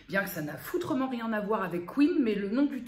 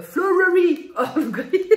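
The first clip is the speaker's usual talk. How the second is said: "flowery Earl Grey" laughed out, higher and louder, slowly; laughing; 8 semitones higher, 10 dB louder, slower than usual. Real, not acted. Laughs at the tricky name. (« flowery Earl Grey » en éclatant de rire)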